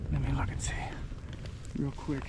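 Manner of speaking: soft whisper to himself; whispered aside. Real, not acted. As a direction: drop to a whisper, quick aside. Quiet aside while checking phone.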